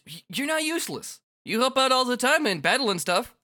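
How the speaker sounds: high-pitched, stammered reassurance; flustered reassurance. Acted. Rindo tells friend she isn't useless, flustered. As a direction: jump in high, insisting.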